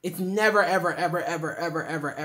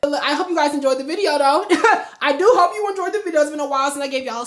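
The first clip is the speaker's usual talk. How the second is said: bubbly outro; 6 semitones higher, 9 dB louder than usual. Real, not acted. Excited outro to viewers.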